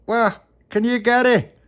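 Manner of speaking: high, loud call across water. Acted. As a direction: shout to someone far off. Calling across the lake.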